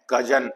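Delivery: कजन said with a short schwa, not कजिन with ee; correct pronunciation. Shown as a demonstration. Teacher corrects students, saying कजन with a soft schwa. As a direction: say कजन with a light uh in the second syllable. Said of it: "यहाँ आई कजिन नहीं है".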